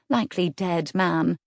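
plain reading voice

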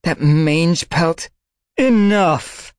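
very high, loud cry; she-cat snapping enough; furious outburst. A narrator's reading. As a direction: explode with rage.

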